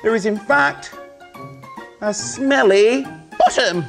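loud, high showman patter; kids party magician. Acted. Magic show for children.

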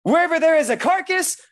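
loud, high, fervent preaching; preaching at full force. Real, not acted. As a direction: preach it out with fire.

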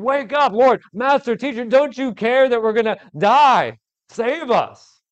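high, loud desperate cry to wake him; panicked yell. Acted. Disciples yelling at sleeping Jesus, high and panicked. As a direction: shout it like men about to drown. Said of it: "yelling at him".

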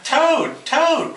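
high, pleading call for a friend; Frog crying out. A narrator's reading. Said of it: "cried Frog".

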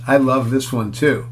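ordinary delivery

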